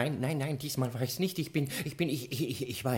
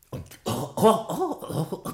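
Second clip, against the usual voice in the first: gasping after a fiery drink; throat burning from whisky; burning from strong liquor; 4 semitones higher, 4 dB louder than usual. Acted. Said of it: "Das brennt wie Feuer".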